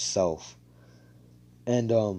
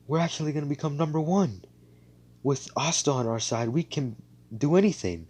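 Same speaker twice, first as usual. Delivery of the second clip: higher, eager cheering; lifted with hope; hopeful excitement; 6 semitones higher than usual. Retold or imitated. Squad hyped about Asta.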